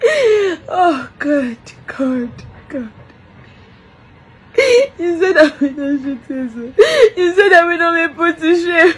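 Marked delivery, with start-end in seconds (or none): none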